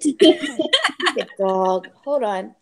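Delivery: loud, very slow grumble; annoyed at dog. Real, not acted. Annoyed at the dog making her lose her train of thought. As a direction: drag out an irritated scolding.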